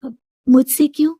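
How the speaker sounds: a hint of surprise; puzzled daughter. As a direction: wonder aloud why. Shilpi wonders why boss calls.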